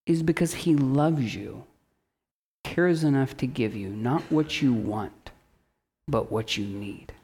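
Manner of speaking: soft and fading, tender; tender hush. Real, not acted. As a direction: ease it down to almost nothing.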